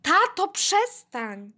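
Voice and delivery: high, drawn-out plea; embarrassed protest. A narrator's reading. Girl tugging singing dad's sleeve, pleading protest. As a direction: drag it out, begging him to stop. Girl begs dad to stop.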